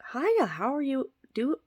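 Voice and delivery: high, sing-song cashier greeting; bright upward cashier hello; chirpy cashier. Retold or imitated. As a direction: chirp it like a cheery counter girl.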